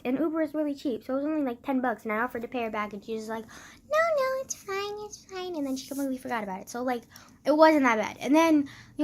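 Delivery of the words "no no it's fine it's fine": high-pitched, dismissive grandma quote; lightly mocking high voice; imitated grandma. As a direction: wave it away in a high little voice. Imitates grandma waving off repayment.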